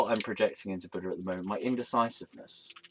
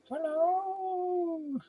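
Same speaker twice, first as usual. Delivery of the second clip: silly high hello. Pretend. Silly greeting to the camera.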